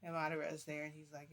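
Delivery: ordinary delivery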